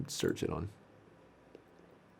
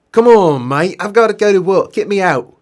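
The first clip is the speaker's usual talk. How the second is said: loud, higher British lad voice; impatient patient; 8 semitones higher, 8 dB louder than usual. Acted. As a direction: urge your mate to get you out.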